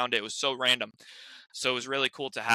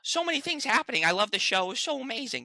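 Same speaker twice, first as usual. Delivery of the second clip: high, strained Adam Goldberg voice, rambling; high, excited Adam Goldberg voice rambling to a fan; 9 semitones higher than usual. Retold or imitated. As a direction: ramble starstruck in his pitched-up voice. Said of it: "I was just talking in his voice".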